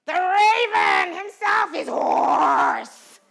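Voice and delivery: slow, loud, booming Lady Macbeth delivery; bold Shakespeare line. Shown as a demonstration. Teacher demonstrating a bold Macbeth line to students, booming. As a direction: declaim it boldly and slowly. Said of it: "So I might do".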